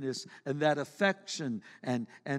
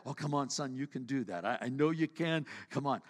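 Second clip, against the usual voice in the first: softer reassuring father voice; gentle encouraging dad; 5 semitones lower, 6 dB quieter than usual. Retold or imitated. Dad softly coaxing his discouraged son, reassuring. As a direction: coax the child kindly, quietly. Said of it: "He encourages them".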